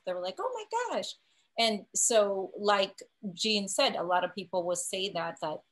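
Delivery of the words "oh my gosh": small high-pitched gasp of awe; retold amazed realization. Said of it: "when they realize".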